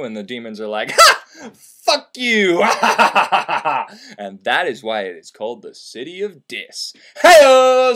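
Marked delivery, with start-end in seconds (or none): 0.9-4.2 sneering gatekeeper demon, laughing; mocking demons. 7.2-7.9 loud, high comic hey-o; belted hey-o after the joke; punchline hey-o. Real, not acted. Punchline on the City of Dis.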